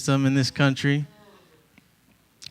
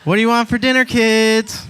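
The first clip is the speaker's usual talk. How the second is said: sing-song mom; 6 semitones higher than usual. Retold or imitated. Mom voiced asking kids.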